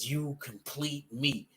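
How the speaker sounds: soft, slow, tender movie-line delivery; Jerry Maguire line. Retold or imitated. The Joker telling Batman he completes him, softly and slowly. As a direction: hush it like a swooning romantic lead. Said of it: "the straight up Jerry Maguire, Tom Cruise".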